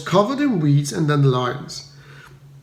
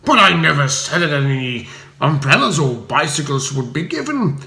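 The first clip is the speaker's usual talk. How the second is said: strangled voice. A narrator's reading. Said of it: "said Spode in a strangled voice".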